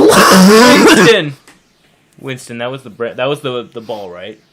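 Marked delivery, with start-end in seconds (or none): 0.0-1.3 high, loud drawn-out call; mock desperate cry. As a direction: holler the name as if losing it. Mock movie cry for lost ball.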